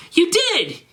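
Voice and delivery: high screaming accusation; screamed. Acted. Draco insists Garfield shot him, high scream. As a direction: shriek it at him. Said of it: "screamed Draco".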